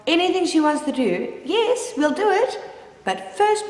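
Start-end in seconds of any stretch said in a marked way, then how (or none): 1.5-3.7 higher, slow, sing-song false sweetness; mock sweet mom voice. Mother mocking her old self to her daughter, higher, slow, sing-song. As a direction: sound cheerfully sweet while putting the child off.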